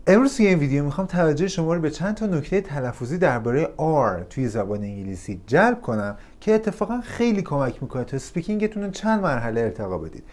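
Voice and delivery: ordinary delivery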